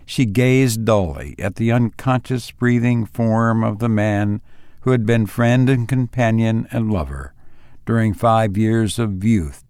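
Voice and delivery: story narration. A narrator's reading. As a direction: read it like a grave tale by the bedside. Reads the story aloud.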